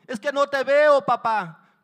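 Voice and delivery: high, loud pleading; panicked shouted protest; frightened child. Acted. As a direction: cry out to your unseen father. Scared child answers father from ledge.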